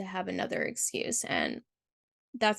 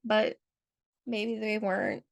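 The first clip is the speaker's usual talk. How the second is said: normal talking voice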